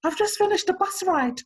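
high-pitched excited retelling; retold excited friend. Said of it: "She was like".

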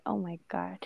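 breathless whisper of alarm; whispered dread. Retold or imitated. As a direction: whisper it like you're caught. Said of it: "It's like".

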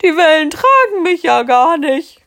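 high, loud giant's wail. Acted. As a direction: whine it in panic as the water rises. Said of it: "jammerte er".

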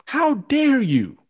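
high, slow, stretched out; outraged. Real, not acted. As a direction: drag it out in disbelief. Furious rant, repeated accusation.

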